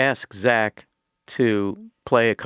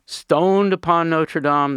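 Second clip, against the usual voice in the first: slow, higher, louder poetic declaiming; beat poet voice; 6 semitones higher, 4 dB louder, slower than usual. Retold or imitated. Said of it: "Beat poet voice".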